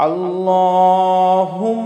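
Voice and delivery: আল্লাহুম্মা with a heavy, full লা and nasal humming on ম; heavy Allah. Shown as a demonstration. Teacher shows heavy Allah and nasal ম, heavy pronunciation. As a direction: make আল্লাহুম্মা thick and let ম hum. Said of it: "আমরা এখানে মোটা করব".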